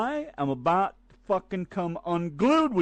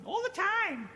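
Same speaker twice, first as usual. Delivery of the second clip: high squawked all the time; [name]'s catchphrase; 13 semitones higher, 5 dB quieter, slower than usual. Retold or imitated. Partner answering with his own catchphrase, high and squawked. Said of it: "what I mean when I say".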